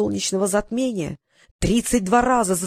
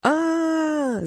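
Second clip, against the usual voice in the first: a high mock wail as he charges; rising wail of a furious chieftain; wailing battle cry; 8 semitones higher than usual. A narrator's reading. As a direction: let out a hammed-up wailing cry. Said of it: "завопил Алхазаб".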